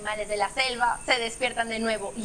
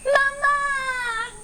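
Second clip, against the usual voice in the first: high, loud cry of mama; baby elephant shouting for its mother; joyful shout; 12 semitones higher, 4 dB louder than usual. Acted. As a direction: call out to mama with delight. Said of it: "grita el cachorro".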